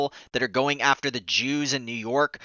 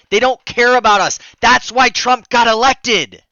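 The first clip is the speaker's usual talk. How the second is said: higher, louder furious rant; shrill anger; 6 semitones higher, 5 dB louder than usual. Real, not acted. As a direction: yell it out in outrage. Escalating rant about politicians.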